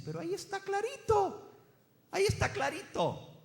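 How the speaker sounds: voz muy aguda de quien lo ve obvio; lector convencido. Acted. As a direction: imita agudo a un lector convencidísimo. (lector convencido, en tono agudo)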